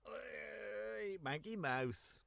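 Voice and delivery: ordinary delivery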